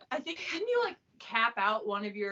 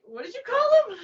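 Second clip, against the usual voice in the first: mock mom yell; 12 semitones higher, 7 dB louder than usual. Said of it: "my mom's like".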